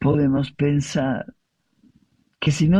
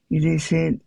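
ordinary delivery

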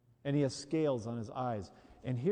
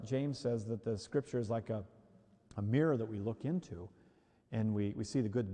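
normal talking voice

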